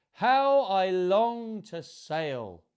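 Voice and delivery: high, loud, long-drawn wish; yearning cry. A narrator's reading. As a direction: call out with longing, stretching the vowels. Snail voices longing to sail.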